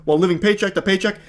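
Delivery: mock macho boyfriend. Mocking the boyfriend declaring his manhood, high and blustering. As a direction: ham up the man puffing himself up. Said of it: "I like how he puts his foot down".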